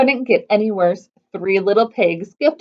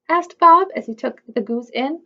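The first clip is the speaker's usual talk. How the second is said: ordinary delivery, nothing marked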